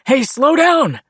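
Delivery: high loud shout from behind; calling out. A narrator's reading. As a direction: yell it after a runner. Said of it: "he called out".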